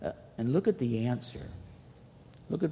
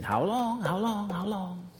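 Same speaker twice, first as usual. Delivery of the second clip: pleading prayer; 7 semitones higher, slower than usual. Retold or imitated. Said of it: "you just keep saying".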